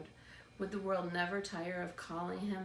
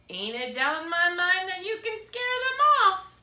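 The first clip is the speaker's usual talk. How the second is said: put-on loud, high old lady voice; 11 semitones higher, 13 dB louder than usual. A narrator's reading. Old lady hanging Edward on the pole, loud and high. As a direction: crow it out like a pleased old woman. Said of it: "the old lady said".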